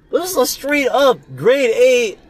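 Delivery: high, slow hammed-up announcer voice; mock announcer. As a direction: sell the egging like a grand ad pitch.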